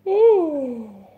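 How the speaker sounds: loud drawn-out yawn; big yawn. Real, not acted. Yawn sound on stream.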